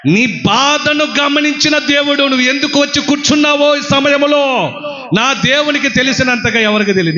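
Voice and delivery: preacher consoling the crying believer; preaching over music. Acted. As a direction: comfort the grieving listener.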